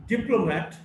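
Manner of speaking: diplomat as DIP-lomat; diplomat, weight on the first syllable; shifting-stress demo. Shown as a demonstration. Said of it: "stress shifts depending on the morphological structure".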